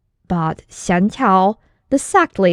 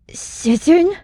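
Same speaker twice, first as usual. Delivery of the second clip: put-on higher, questioning young man; young disciple; 9 semitones higher than usual. Disciple looks to his master, higher and questioning. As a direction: ask the master with a rising lilt.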